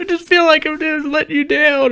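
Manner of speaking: very high mock-tearful whine; imitated crying wife. Sick wife feeling she fails her husband, mock crying. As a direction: squeak it out as a weepy impression. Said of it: "she's, like, crying and shit at me".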